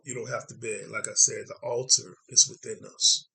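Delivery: normal talking voice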